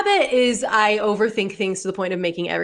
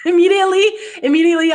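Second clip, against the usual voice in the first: laughing; 9 semitones higher, 3 dB louder, much faster than usual. Real, not acted. Laughing at own panic.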